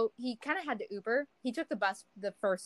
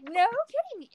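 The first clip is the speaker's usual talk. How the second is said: high-pitched retold amazement; amazed; 14 semitones higher than usual. Passenger hearing driver explain the stain, amazed. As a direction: act out wowed disbelief, pitched up.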